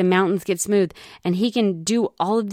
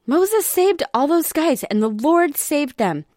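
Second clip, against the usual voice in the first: Nephi insisting; 8 semitones higher, slower than usual. Retold or imitated. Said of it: "And then he says".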